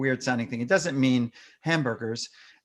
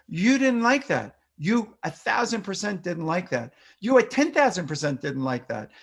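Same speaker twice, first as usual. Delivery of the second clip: higher, emphatic, repetitive empathy; older-child mirroring; 7 semitones higher than usual. Shown as a demonstration. Parent acknowledging an older child's frustration, higher and emphatic. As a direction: show the child you truly get their frustration. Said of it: "still will use repetition and some of your tone".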